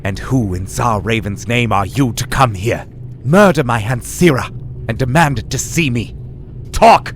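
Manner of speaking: building to a furious shout. Acted. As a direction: build from cold rage to a roar. Glotha furious at intruder.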